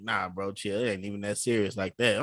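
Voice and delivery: hushed "chill, bro" to a coworker about to swing; soft calming. Retold or imitated. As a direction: talk him down gently.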